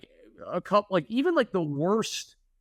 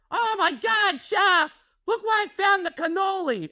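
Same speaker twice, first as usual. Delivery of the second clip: mother-in-law's shriek. Retold or imitated. Mother-in-law finding another hidden cannoli, high outraged shriek. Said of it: "she would come down going".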